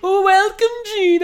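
high, loud squealed welcome; excited greeting. Real, not acted.

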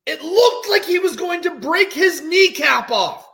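high, loud exclamation; alarmed excitement. Real, not acted. Host recounting a near knee break, alarmed and loud. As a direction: raise the voice in horrified amazement.

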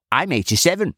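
high-pitched aged boast; proud old man. A narrator's reading. Old man waking from reverie, proud high aged voice.

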